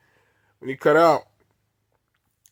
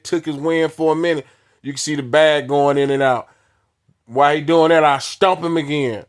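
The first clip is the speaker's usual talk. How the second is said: ordinary delivery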